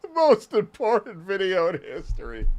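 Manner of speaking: high, put-on mock grandeur; mocking echo. Streamer parroting a YouTuber's video title, mocking. Mimicking the title just heard.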